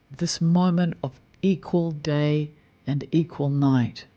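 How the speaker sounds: ordinary delivery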